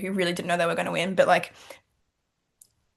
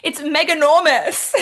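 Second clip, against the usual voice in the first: high, slow, laughing awe at the crown; half-laughing retelling of crown awe; laughing, amazed; 8 semitones higher, 6 dB louder, slower than usual. Retold or imitated. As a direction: stretch the made-up word out, giggling. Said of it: "I kept saying".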